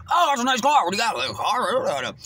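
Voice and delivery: high, loud New Yorker impersonation; loud talker. Retold or imitated. Imitating an aggressive homeless New Yorker talking at him, loud talker. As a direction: burst in as an overbearing loudmouth.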